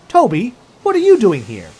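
loud, high, sharp demand; irritated snap. A narrator's reading. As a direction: snap at an unexpected arrival. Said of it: "he snapped".

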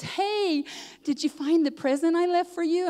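high, sing-out hello, imitating a friend; eager greeting. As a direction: call out high as you walk in with news. Friend walks in greeting her.

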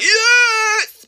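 high, loud happy yelp; mock cheer. Girl tells viewers how she cheered at the fire alarm. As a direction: cheer like a kid let out of class. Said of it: "I was like".

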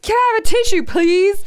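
mock yell. Re-enacting asking friend for tissue, mock yelling. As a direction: bark the request in mock outrage. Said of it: "'Cause I was just like".

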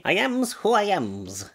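slow, lightly done Popeye on "I am's who I am's"; a hint of the cartoon sailor, slowly. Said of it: "say it in a Popeye voice".

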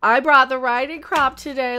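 normal talking voice

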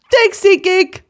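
high, loud mock-cheery thanks; chirpy sign-off. Host thanking the podcast sponsor, high and gushing. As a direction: squeal a thank-you to the sponsor.

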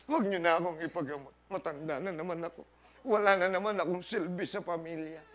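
hammed-up self-pitying elder, low and hurried; mock dramatic old folk. Preacher mocks elderly people's drama about being useless, hammed-up whiny old voice. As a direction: play the martyr grandparent for laughs. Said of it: "ito pa drama ng mga matatanda".

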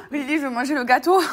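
loud playful plea; loud childish whine about cake; mock whining demand. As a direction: beg loudly like a greedy child. Retells whining to Lily.

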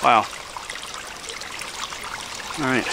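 normal talking voice

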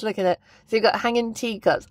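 ordinary talk, nothing marked

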